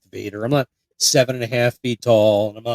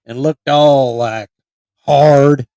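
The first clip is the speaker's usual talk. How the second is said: loud, hammed-up hillbilly accent; hillbilly reminiscing; 11 dB louder, much slower than usual. Imagined local retelling the tale at Thanksgiving, loud hillbilly accent. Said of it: "sitting around at Thanksgiving".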